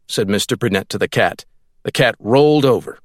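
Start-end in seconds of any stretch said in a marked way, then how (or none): none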